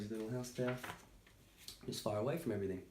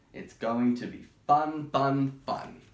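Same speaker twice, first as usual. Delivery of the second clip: slow, louder fun fun fun; cheery, drawn out; 5 dB louder, much slower than usual. Acted. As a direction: stretch each fun like an invitation. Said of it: "It added".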